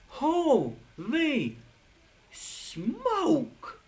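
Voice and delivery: high, loud burst of disbelief; stunned gasp of holy smoke; shocked exclamation. Real, not acted. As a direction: blurt it out like you can't believe it. Shocked at possible death.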